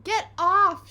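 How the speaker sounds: high loud shout. A narrator's reading. Henry shouting at Peter over the seat. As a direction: shove him away with it. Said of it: "shouted Henry".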